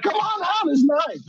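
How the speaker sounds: high, cheery invitation; mock carefree neighbour calling out; breezy neighbour. Comic voicing the relaxed locals inviting him outside, high and cheery. Neighbours calm after storm.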